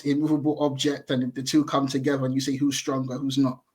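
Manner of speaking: usual speaking voice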